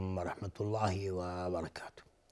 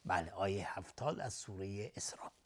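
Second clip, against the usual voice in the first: whisper; 3 semitones lower, 9 dB quieter than usual. Real, not acted. Aside after recitation.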